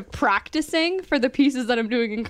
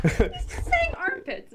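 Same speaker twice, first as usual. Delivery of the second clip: laughing; 4 dB quieter, much slower than usual. Real, not acted. Laughing at the armpits joke.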